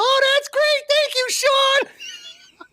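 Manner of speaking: very high, drawn-out mock wife voice; squealed, stretched-out thanks; wife in the back. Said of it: "his wife in the back says".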